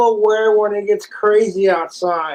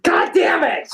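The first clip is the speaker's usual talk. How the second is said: frustrated outburst; 9 semitones higher than usual. Real, not acted. Loses game, frustrated.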